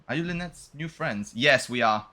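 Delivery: normal talking voice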